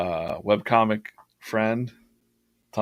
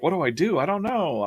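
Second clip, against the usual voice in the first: panicked self quote; 10 semitones higher than usual. Retold or imitated. Retells own panic over a deadline.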